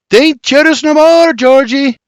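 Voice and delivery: high, loud taunting yell. A narrator's reading. Mary yelling a taunt back at her brother, loud and high. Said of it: "She yells".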